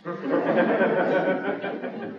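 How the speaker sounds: a warm chuckle after the punchline; laughing. Real, not acted. Preacher laughing at the story's punchline to congregation. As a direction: laugh at his own joke.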